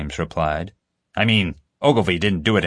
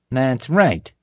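hostile agreement; 6 semitones higher, 3 dB louder than usual. A narrator's reading. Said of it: "staring bullets at the prisoner".

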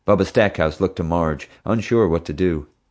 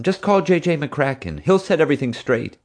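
pleading mother; 9 semitones higher than usual. Acted.

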